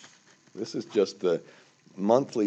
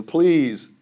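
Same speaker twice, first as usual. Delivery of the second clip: raised, pleading please; emotional plea; 8 semitones higher, 8 dB louder than usual. Real, not acted. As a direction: beg them, getting worked up. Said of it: "Sorry, I got emotional about that".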